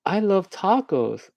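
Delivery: slow, very high, stretched-out delight; mock gushing. Unwitting extra in the movie, mock gushing. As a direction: draw it out high like an overkeen extra.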